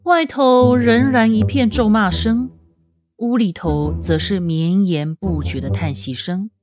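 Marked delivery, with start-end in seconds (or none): none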